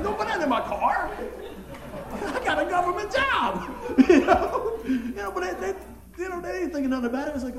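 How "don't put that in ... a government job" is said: laughing, higher, louder protest; laughing refusal. Retold or imitated. Preacher laughingly turning down beers in his truck. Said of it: "I'm like".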